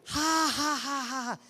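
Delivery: high mock cackle fading away; mocking laughter. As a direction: imitate a possessed woman's sneering laugh. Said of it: "Y se levantó".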